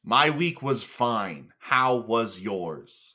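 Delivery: deliberately slow, overly polite; slow mock-formal. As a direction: restart the chat in slow motion.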